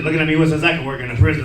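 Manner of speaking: ordinary delivery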